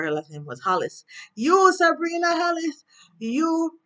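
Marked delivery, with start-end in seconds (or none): 1.3-3.8 loud accusing. Retold or imitated. Jacoby accusing Sabrina at the bank, loud and high. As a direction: call her out by name at full volume. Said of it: "being all loud".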